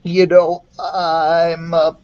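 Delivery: hesitant, slow and nervous confession; a touch of nervous boy voice, dragging the words. Acted. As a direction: admit it while gulping with nerves. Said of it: "he said nervously".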